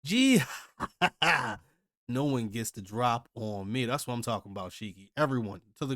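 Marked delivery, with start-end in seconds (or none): 0.0-1.6 high, loud hammed-up gloating laugh; mock villain laugh. Voicing Shiki's triumphant laugh.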